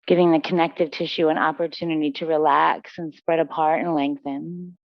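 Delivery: low, even meditation voice with long pauses; guided relaxation. Real, not acted. Yoga teacher guiding students into breath and stillness, calm guided relaxation.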